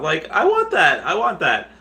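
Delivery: begging for the little hats, high and eager; eager want. Real, not acted. Craving the merch hats.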